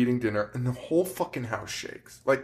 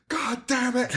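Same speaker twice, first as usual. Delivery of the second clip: mock scream; 11 semitones higher, slower than usual. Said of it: "the dad is screaming".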